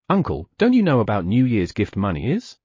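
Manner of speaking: usual speaking voice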